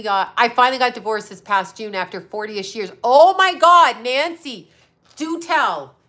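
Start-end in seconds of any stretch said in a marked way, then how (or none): 3.0-6.0 high, loud burst of excitement, then eager prompting; thrilled for a friend; delighted exclamation. Real, not acted. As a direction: exclaim big at juicy good news. Reacting to a viewer's divorce news.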